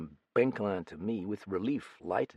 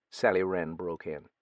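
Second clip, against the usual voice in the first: ordinary delivery, nothing marked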